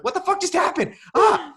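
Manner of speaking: very high, loud freak-out ending in a yelp; mock panicked shriek. Viewer reacting to the mermaid scene, hammed-up panicked shriek. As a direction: scream it in exaggerated alarm. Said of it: "You're like".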